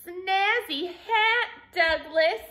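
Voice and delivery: high, stretched-out sheep voice; baaing sheep. A narrator's reading. Sheep admiring Douglas's new hat, light baaing voice. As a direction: draw the words out like a sheep. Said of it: "baaed the sheep".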